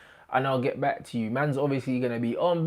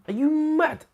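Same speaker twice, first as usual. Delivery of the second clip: mock disbelief; 14 semitones higher than usual. Mock outrage at own team.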